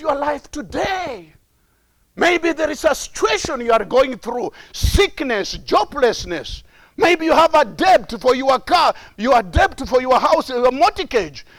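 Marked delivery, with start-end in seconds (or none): none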